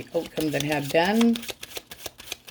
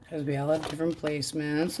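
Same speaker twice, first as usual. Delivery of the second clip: ordinary talk, nothing marked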